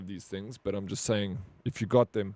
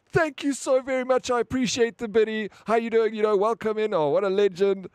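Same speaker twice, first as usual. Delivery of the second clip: much higher, overjoyed thank-you voice; gushing streamer; 10 semitones higher, 3 dB louder than usual. Retold or imitated. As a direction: ham up a grateful streamer. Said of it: "You give him a biddy".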